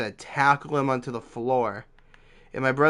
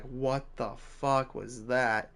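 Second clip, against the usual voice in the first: slow, softer mock disbelief; stunned disbelief; 6 dB quieter, slower than usual. Son to both parents once the guests leave, stunned disbelief. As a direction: draw it out softly, mildly stunned.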